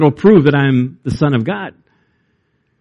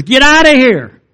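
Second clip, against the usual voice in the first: a much higher mock shout, driving them out; commanding shout; 9 semitones higher than usual. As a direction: throw them out with a raised voice. Jesus drives out the merchants.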